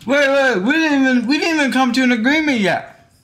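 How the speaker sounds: talking while eating; words round a bite of food; mouth full. Real, not acted. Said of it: "I'm eating right now".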